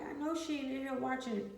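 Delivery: high, disbelieving outrage; you can't be serious; incredulous. Retold or imitated. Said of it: "I'm like".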